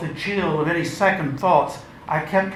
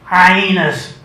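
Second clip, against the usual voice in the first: dismissive scorn; 3 semitones higher, 8 dB louder than usual. Acted. Dismissing her friends.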